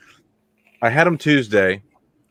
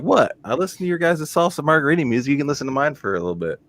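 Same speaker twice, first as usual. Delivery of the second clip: defiant retort; 6 semitones higher than usual. Retold or imitated. Retelling his reply to coworkers.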